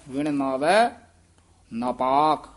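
a pause after ਨਾਵੈ, then ਨਾਪਾਕ as one word; ਵਿਣ ਨਾਵੈ, a break, then ਨਾਪਾਕ whole; careful demo. Shown as a demonstration. Teacher demonstrates ਨਾਪਾਕ said as a single word. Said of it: "ਨਾਪਾਕ ਇਕੱਠਾ ਹੀ ਉਚਾਰਨ ਕਰਨਾ ਹੈ".

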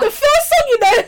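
high, loud, laughing exclamation; excited laughing shout. Real, not acted. Excited laughing agreement about song.

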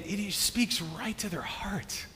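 "and, and he speaks right to their heart" in a slow, awed whisper; hushed, moved whisper. Real, not acted. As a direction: breathe it out, almost secret. Moved, describing Joseph's tenderness.

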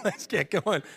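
high, laughing repeat of the call; laughing wake-up call. Retold or imitated. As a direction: laugh it out while urging them. Rousing family early on Sundays.

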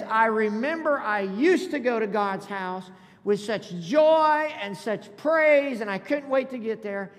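loud preacher's recollection, stretching the joy; fervent preaching. Acted. Preacher voicing the psalmist remembering worship, fervent and loud. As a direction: preach it loud, lingering on the key words.

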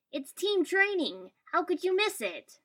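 loud, high, indignant scolding; accusing. A narrator's reading. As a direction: demand an explanation loudly from a friend. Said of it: "he said accusingly".

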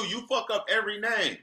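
usual speaking voice

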